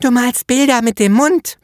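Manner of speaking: put-on high child's voice, eager; little girl. A narrator's reading. Little girl finishing the painter's sentence, high child voice. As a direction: pipe up like a small know-it-all girl. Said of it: "beendete ein kleines Mädchen".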